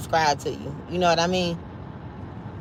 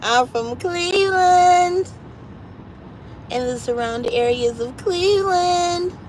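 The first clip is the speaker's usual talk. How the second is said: playful hometown boast; 10 semitones higher than usual. Pretend. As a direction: stretch Cleveland out long and high, joking. Jokingly proclaims her hometown.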